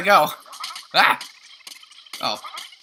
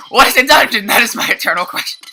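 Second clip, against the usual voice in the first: excited rhetorical question; 8 semitones higher, 9 dB louder than usual. Real, not acted. Mock puzzled question to viewers.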